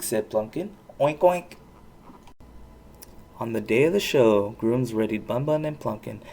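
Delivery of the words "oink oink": mock oink. A narrator's reading. Plumpkin mocks Poniella as pig.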